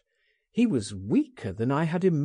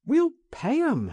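quick, high and louder; eager reply; 3 dB louder than usual. A narrator's reading. Friend brushing off the sureties, high eager reply. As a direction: sweep the worry away eagerly. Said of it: "I replied".

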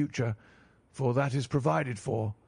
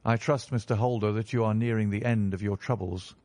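ordinary delivery

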